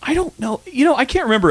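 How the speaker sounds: high squeaky shrug of an answer; mock Angus voice. Angus voice answering the co-host, high and squeaky.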